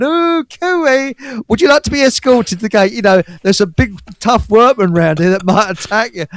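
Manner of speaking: high, loud, fast simpering voice; mock simpering. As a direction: coo at him like a fussing nanny. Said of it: "we were taking the piss".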